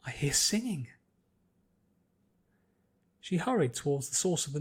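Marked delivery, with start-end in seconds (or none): 0.1-0.9 hushed. Acted. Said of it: "Pinky hushed".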